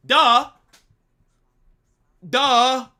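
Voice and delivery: loud sarcastic duh; loud mocking duh. Real, not acted. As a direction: spit out a scornful duh. Mocking obviousness.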